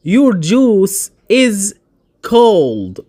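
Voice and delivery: your juice is, very slow and clear; slow example. Shown as a demonstration. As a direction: stretch each word for learners. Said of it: "مثال". (your juice is ببطء شديد)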